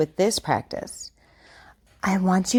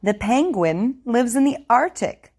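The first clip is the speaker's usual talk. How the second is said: over enunciating. Shown as a demonstration. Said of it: "I want you to repeat after me".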